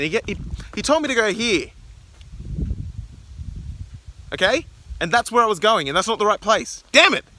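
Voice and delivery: frustrated rant. Real, not acted. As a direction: vent at Jason, pitch climbing. Ranting about wrong directions.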